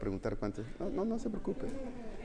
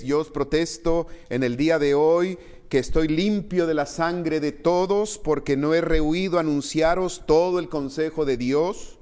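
raised, earnest scripture reading to the church; 3 semitones higher, 5 dB louder than usual. Acted. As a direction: proclaim the verses to the congregation. Pastor reads scripture aloud. (lectura solemne de la Escritura)

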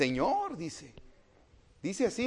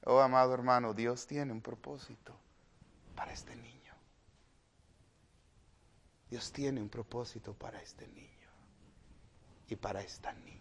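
soft, whispered tender words; hushed whisper; 7 semitones lower, 11 dB quieter than usual. Acted. Preacher whispering to the beloved brother about God's purpose.

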